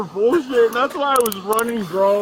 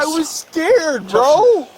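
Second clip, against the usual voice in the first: high, slow pleading; 8 semitones higher, 3 dB louder, much slower than usual. Real, not acted. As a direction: plead with the officer. Caught suspect pleading.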